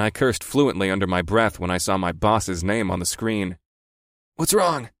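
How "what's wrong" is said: higher concerned friend; lightly voiced buddy.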